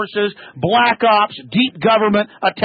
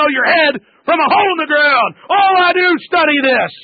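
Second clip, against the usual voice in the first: angry shouting; 6 semitones higher than usual. Real, not acted. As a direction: tear into the caller, shouting. Host berating phony conservative caller.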